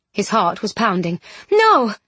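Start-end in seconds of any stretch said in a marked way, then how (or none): 1.5-1.9 high, loud single-word protest; flustered exclamation. A narrator's reading. Bronte reacting after the kiss, flustered. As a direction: blurt it out in alarm.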